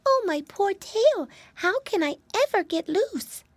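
tiny, high plaintive voice; distressed little mouse. Acted. Mousy girl with trapped tail, high and plaintive.

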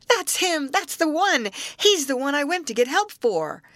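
put-on high, childlike excited shouting; excited cry. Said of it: "danced about like a child and cried".

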